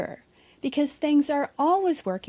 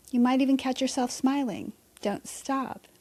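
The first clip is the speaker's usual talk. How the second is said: normal talking voice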